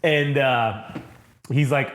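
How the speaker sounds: normal talking voice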